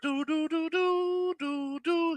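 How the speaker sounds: high sung alert jingle; high do-do-do alert chirp; alert tone imitation. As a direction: mimic a phone notification chime. Mimicking an alert sound.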